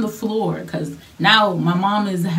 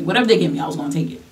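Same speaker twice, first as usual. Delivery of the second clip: ordinary talk, nothing marked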